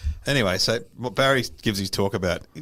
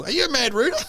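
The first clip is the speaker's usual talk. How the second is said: mocking old man; 13 semitones higher, faster than usual. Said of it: "What an opening line".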